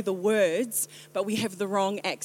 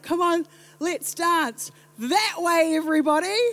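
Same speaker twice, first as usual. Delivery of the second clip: worship leader; 8 semitones higher, 4 dB louder than usual. Retold or imitated. Worship leader urging everybody to dance, mock enthusiasm. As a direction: imitate an over-keen leader, pitched up and louder. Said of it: "when the worship leader stands up here".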